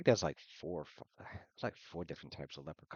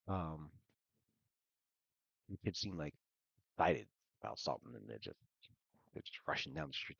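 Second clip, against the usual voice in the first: ordinary talk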